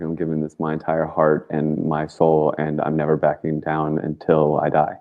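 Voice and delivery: usual speaking voice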